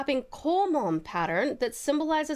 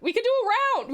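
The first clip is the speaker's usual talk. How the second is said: ordinary talk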